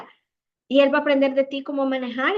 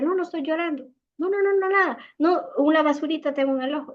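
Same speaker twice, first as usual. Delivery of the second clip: mother brushing off tears; 5 semitones higher than usual. Retold or imitated. Mother hiding tears from child.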